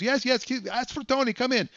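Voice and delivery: very high, rushed yes-yes; eager salesman. Retold or imitated. Tony eager to sell.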